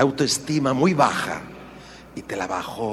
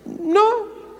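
high squeaky no. Retold or imitated. Mute husband.

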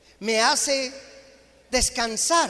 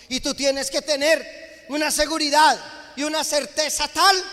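fiery preaching; 3 semitones higher than usual. Acted. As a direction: preach it at full force to the congregation. Preaches at full force.

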